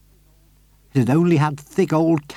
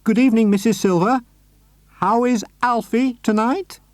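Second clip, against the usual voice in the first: much higher, bright neighbourly call; 11 semitones higher than usual. A narrator's reading. Mister Hoppy calling out to his neighbour. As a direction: call across cheerily. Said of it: "called out".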